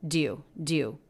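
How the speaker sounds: "Do you" run together as one syllable, "dyou". Shown as a demonstration. Teacher blending "do you" into one syllable. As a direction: join the D straight onto "you". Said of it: "connecting the D right onto the word you".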